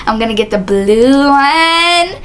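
slow, stretched-out BLUE ONE; playfully drawn out. Pretend. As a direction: linger on "blue one", teasing it out. Playful drawn-out repeat of line.

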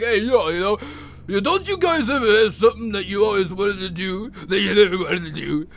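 slurred, earnest boxer impression; Rocky. Rocky pleads his case before the boxing tribunal, slurred impression. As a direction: plead in a put-on boxer's mumble. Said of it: "plead his case".

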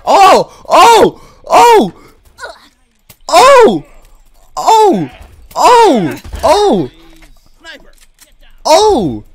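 loud, high, repeated oh yells; excited yells. Real, not acted. Player yelling oh while fighting, loud excited yells. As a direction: yell out every hit.

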